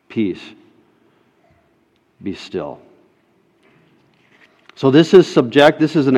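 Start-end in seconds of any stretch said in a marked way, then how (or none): 0.1-2.8 "Peace" and "Be still" hushed, much softer; near whisper. Acted. Preacher calming his listeners' fears, hushed. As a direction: breathe the words almost silent.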